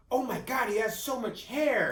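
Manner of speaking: high, loud, slowed gasp of delight; amazed doctor. Retold or imitated. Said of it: "Doctor Lerner goes".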